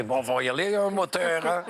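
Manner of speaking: hammed-up Belgian accent. Comedian replaying how he teased the film crew, mock Belgian accent. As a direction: call it out in a joking Belgian accent. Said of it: "quand je fais ça".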